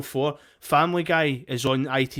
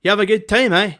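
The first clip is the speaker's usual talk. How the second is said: put-on a light Belfast accent, higher and louder, teasing; Belfast man impression; 7 semitones higher, 5 dB louder, faster than usual. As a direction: lightly do the Belfast guy ribbing him. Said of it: "he was like".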